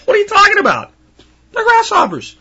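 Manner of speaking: very high scoffing disbelief; mocking incredulous voice. Mocking dismissive people scoffing at preparation, high and incredulous. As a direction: sneer at the idea of preparing. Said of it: "And they go".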